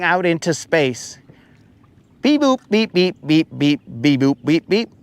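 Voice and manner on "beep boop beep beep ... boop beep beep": robot beeps. Pretend. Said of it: "in case there's, like, robot aliens".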